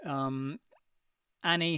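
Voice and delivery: normal talking voice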